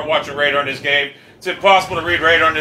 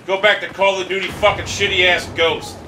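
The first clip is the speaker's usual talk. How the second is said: normal talking voice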